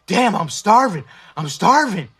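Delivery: loud hungry groan of a complaint; loud, hammed-up hunger complaint; mock starving complaint. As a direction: whine loudly about starving. Imitating feeling hungry.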